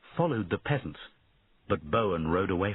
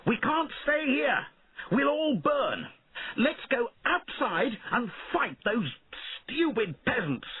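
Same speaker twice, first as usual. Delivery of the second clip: put-on young king yelling to his knights amid fire; panicked shout. A narrator's reading. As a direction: yell over the flames. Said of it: "watched and shouted".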